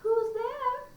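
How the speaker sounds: grandmother voice. Acted. Grandmother answers door.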